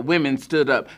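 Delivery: ordinary delivery, nothing marked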